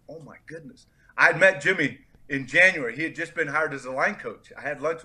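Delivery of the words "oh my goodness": very soft, stunned aside; retold hushed amazement; hushed disbelief. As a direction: say it under your breath, as if stunned. Retold stunned reaction to draft call.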